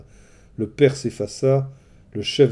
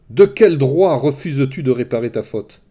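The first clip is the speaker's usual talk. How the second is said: loud, higher furious yell; angry yell; 4 semitones higher, 8 dB louder than usual. A narrator's reading. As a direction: hurl the accusation at the king. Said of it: "hurla-t-il à l'intention d'Agamemnon".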